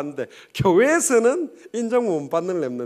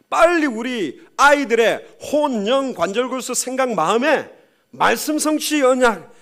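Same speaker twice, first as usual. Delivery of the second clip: loud, raised, ringing sermon cry; full-force preaching; 6 semitones higher, 8 dB louder than usual. Acted. Preacher urging the church to plant the covenant, full-force preaching. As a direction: hammer each covenant home to the congregation.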